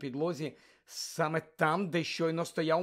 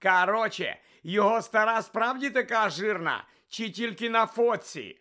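mocking jeer; 7 semitones higher, 7 dB louder than usual. Malfoy mocking Ron's mother.

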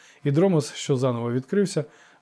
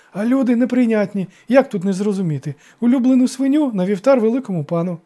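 high, loud, gushing compliance; fawning, excited servant; joyful eagerness; 9 semitones higher, 7 dB louder than usual. A narrator's reading. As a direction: show eagerly that you understand. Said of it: "радісно закивав староста".

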